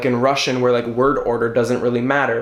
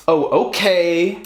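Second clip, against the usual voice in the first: okay drawn out slowly with a higher rising end; exaggerated, slow, pitched-up okay; funny emphasis; 5 semitones higher, slower than usual. Shown as a demonstration. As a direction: stretch okay for comic effect. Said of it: "we use emphasis on syllables to, like, get our meaning across or to be funny".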